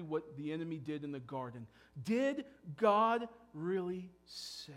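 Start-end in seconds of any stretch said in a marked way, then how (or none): 2.0-4.7 slow, doubting tempter voice, drawn out; serpent's sly question. Acted. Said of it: "here comes the evil one".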